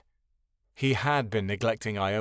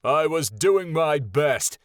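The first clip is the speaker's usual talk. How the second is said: slow, louder booming anger; booming, furious declaration; thundering; 4 semitones higher, 5 dB louder, slower than usual. Acted. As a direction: thunder each word out. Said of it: "he thundered".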